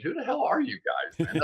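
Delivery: high-pitched mock incredulity; quick, puzzled challenge with a jump in pitch; incredulous demand. Guest retelling his message to unknown analysts, incredulous demand.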